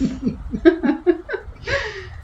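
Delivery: high, light laughter; chuckling at her friend; amused laugh. Acted. Laughs at feeding the ducks.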